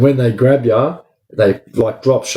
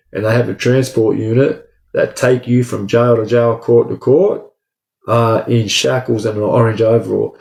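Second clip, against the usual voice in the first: usual speaking voice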